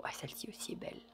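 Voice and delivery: hushed, breathy whisper of admiration; awed whisper. Real, not acted. Admiring each tarot card for viewers, in a hushed whisper. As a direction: breathe it out softly, in awe.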